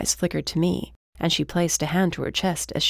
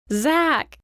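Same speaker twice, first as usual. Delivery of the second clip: high, raised-voice call; 11 semitones higher, 4 dB louder than usual. A narrator's reading. Briar calls to companion from down the hall, calling out. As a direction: call to someone in another room. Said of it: "Briar called out".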